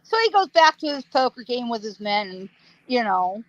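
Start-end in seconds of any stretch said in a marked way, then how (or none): none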